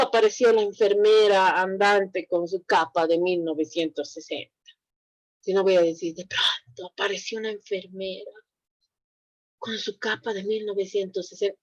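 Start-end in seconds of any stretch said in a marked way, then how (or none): 6.1-11.6 hushed, suspenseful storytelling voice, much softer; storyteller hush. Shown as a demonstration. Teacher performing a horror tale for the class, hushed suspense. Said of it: "es diferente la entonación que yo manejo".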